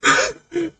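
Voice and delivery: shrill gleeful villain cackle; high villain cackle. Acted. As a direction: cackle high like a mad lich. GM villain laugh.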